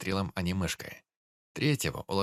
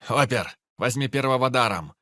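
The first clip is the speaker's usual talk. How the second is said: loud, high barked order; barking shout; 6 semitones higher, 9 dB louder than usual. Acted. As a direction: bark the command at him. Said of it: "рявкнул пёс".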